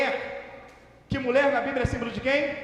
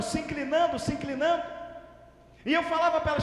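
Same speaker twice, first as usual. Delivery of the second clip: ordinary talk, nothing marked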